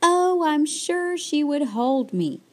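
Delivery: high, plaintive little bird; mock sob, pitched well up; acted sobbing. A narrator's reading. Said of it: "sobbed Choco".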